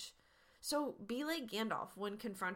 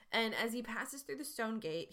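ordinary delivery

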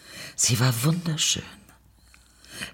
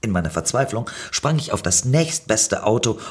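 plain reading voice

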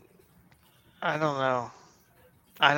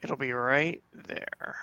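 slow, quiet, drawn-out "right there"; drawn-out tease; 7 dB quieter, much slower than usual. Pretend. Teasing his co-host about the belt, slow and quiet. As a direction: stretch it out like a slow sales pitch.

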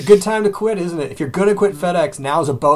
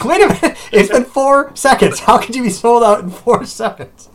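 high, loud, stunned disbelief; incredulous outburst; 6 semitones higher, 5 dB louder than usual. Retold or imitated. Gamer ranting at a sold-out store, incredulous and loud. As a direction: wait a minute, how is that possible.

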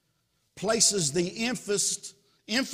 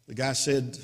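ordinary delivery

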